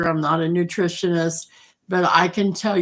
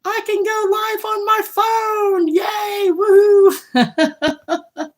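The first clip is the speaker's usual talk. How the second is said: very high, sustained squeal of excitement, acted out; hammed-up celebratory shout, breaking into laughter; excited big-letters yell; 4 dB louder, much slower than usual. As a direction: re-enact an all-caps text, rising to a loud woohoo. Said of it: "here I am texting you in big letters".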